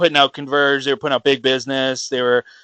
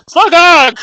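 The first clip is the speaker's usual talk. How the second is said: blurted out high and loud; excited shout; 13 semitones higher, 9 dB louder than usual. Retold or imitated. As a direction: yell the name out without thinking. Blurts name on seeing him.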